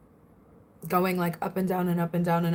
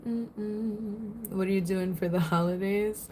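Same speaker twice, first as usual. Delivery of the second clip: ordinary delivery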